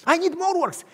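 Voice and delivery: high, loud begging; insistent high plea; desperate plea. Acted. Worker pleading again.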